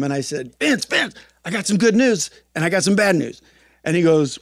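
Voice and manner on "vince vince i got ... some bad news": high and louder, bursting to tell; retelling an excited phone call; excited news. Retold or imitated. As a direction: blurt out the news eagerly. Excitedly calling his sponsor.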